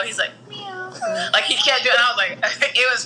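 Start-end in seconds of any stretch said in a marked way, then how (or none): 0.5-0.9 soft, low meow like a real cat; a hushed, realistic cat meow. Retold or imitated. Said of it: "He did it so low".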